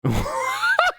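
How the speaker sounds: very high squealing laugh; shrieking laugh. Real, not acted. Laughing at her cohost's line, high squeal. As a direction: shriek with delight.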